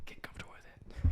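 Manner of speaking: hushed ASMR whisper. Shown as a demonstration. Host urging guest to settle in, whispering. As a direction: breathe the words without voice. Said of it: "need to do is just whisper".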